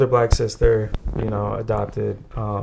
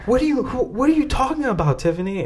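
high-pitched imitation of a teacher's Chinese accent; mock Chinese-accented teacher demanding an explanation; Miss Fang; 12 semitones higher, faster than usual. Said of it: "And a very harsh, um, Chinese accent".

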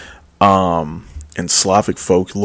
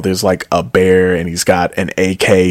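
normal talking voice

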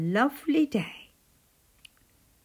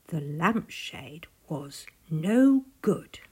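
plain reading voice

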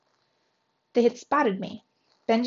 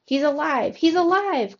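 high, jubilant cry of relief; thrilled, raised-pitch exclamation; excited cry; 6 semitones higher, 3 dB louder than usual. A narrator's reading. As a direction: cry out the good news, climbing higher on the repeat. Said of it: "cried my cousin".

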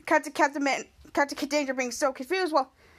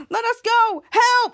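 high, loud mock plea; play-acted desperate yelling; acted cry for help; 7 semitones higher, 5 dB louder, much slower than usual. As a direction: shout to be let out, pretending to be trapped. Retelling trapped characters calling out.

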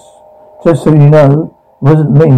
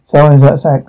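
usual speaking voice

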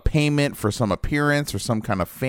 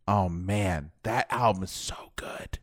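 hushed, reverent whisper; 4 semitones lower, 8 dB quieter, slower than usual. Real, not acted. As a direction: breathe it out in awe. Reverent hush about the album.

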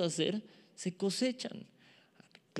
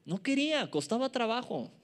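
usual speaking voice

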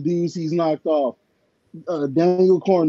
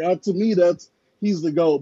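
ordinary delivery

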